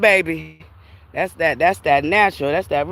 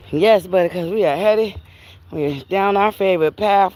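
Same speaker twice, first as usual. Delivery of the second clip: normal talking voice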